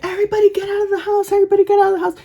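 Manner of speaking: high, rushed mock panic; imitated urgent alarm. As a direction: play an aunt hurrying everyone out. Aunt's fire alarm retold.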